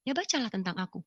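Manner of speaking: soft, unhurried reply; retold divine voice. God answering the woman's question, soft and calm. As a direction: answer quietly as the unseen voice. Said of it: "Tuhan cuma bilang".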